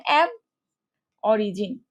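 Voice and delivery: অ্যাব, pause, then অরিজিন; word split in parts. Shown as a demonstration. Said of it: "এখন দেখো কিভাবে ভাগ করব". (অ্যাব আর অরিজিন আলাদা করে থেমে বলা)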